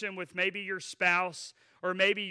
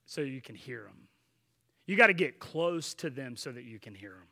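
usual speaking voice

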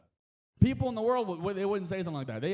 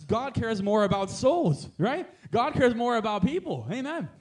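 higher, louder, slow preaching; 4 semitones higher, 6 dB louder, slower than usual. Real, not acted. Preacher stressing souls to the church, loud preaching. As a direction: drive it home to the congregation. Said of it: "preaching at full force".

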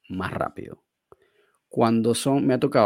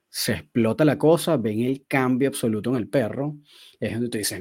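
ordinary talk, nothing marked

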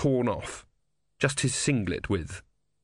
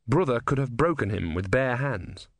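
plain reading voice

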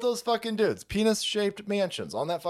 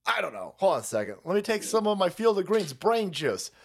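ordinary delivery

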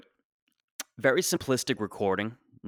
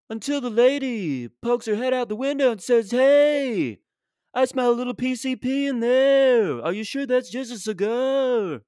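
[name]-style storytelling; 11 semitones higher than usual. Retold or imitated. Comic voicing the neighbor inside his [name] parody. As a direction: keep the [name] croon through the neighbor's line.

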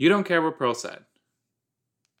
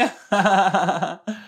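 high, loud staccato cackle; cackling laugh; 7 semitones higher, 5 dB louder than usual. Real, not acted. Viewer tickled by the crazy woman, cackling. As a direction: burst into a long high laugh.

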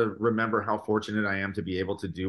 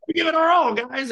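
mock rally cry; 6 dB louder than usual. Mock rallying quote to crowd.

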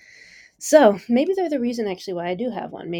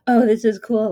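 mock starstruck gush; 3 semitones higher, 8 dB louder than usual. Celebrity-struck collaborators imitated.

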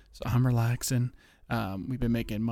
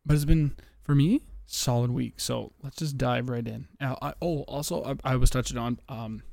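normal talking voice

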